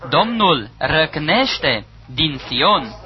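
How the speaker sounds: slow, grave scripture declaration; slow solemn proclamation. Acted. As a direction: draw each word out slowly, weighty. Preacher repeats the key verse.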